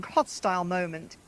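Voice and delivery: plain reading voice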